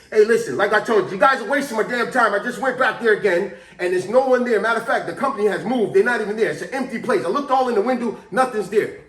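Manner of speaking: loud, exasperated radio report; an old driver scolding dispatch over the radio; irritated old man complaint. Retold or imitated. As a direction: vent that they're wasting your time. Jerry complains his time is wasted.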